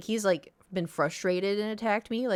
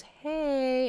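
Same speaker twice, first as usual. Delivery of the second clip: vet's knowing hey; 8 semitones higher than usual. Retold or imitated. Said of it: "and she goes".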